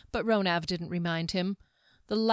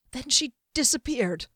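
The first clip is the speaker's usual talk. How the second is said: nervous servant; 6 semitones higher than usual. A narrator's reading. Ronav reports the vanishing.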